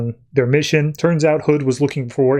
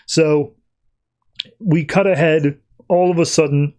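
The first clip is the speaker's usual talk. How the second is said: ordinary delivery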